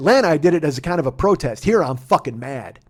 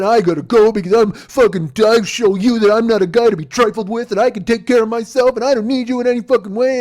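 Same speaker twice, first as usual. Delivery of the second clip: louder, puffed-up tough-guy voice; mock macho boast; 6 semitones higher, 8 dB louder than usual. Mocking his own tough-guy excuse to his girlfriend, loud puffed-up swagger. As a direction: swagger like nobody can touch you. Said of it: "and said".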